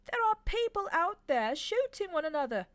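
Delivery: high, loud, agitated angel voice; the prim angel, raising his voice in alarm; a startled, prissy angel protesting loudly; fussy angel. Acted. Aziraphale's voice.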